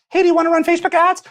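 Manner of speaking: high, fast mock sales pitch; mock pushy marketer. Mocking a marketer who chirps up, high and fast. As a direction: squeak it out like an eager salesman.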